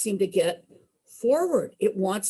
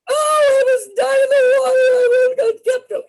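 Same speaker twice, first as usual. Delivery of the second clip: high loud frantic wail; frantic cry; 13 dB louder than usual. Retold or imitated. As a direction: wail it, half panic half relief. Wife answers after near drowning.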